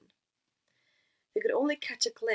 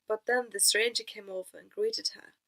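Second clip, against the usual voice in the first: usual speaking voice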